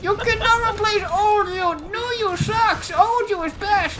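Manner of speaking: very high, loud silly voice; mock sacred-slot defender. Mocking the slot rule as a zealot, loud squeaky voice. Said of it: "World just still was just like".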